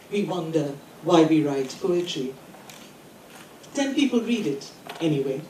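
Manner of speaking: measured poetry recital with deliberate pauses; poem reading. Acted. Poet reading a poem about poems to an audience, measured recital. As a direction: read it in short phrases, pausing after each. Reads own poem aloud.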